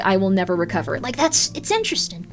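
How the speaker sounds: ordinary talk, nothing marked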